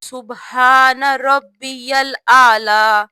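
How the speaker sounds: سبحان ربی الاعلی slowly with the full doubled b in ربی; slow correct pronunciation. Shown as a demonstration. Teacher giving the correct word, slow and clear. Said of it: "صحیح طریقہ".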